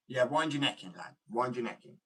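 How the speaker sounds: ordinary delivery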